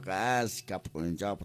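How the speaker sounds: ordinary talk, nothing marked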